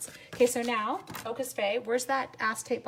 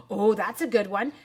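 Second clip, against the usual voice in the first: ordinary talk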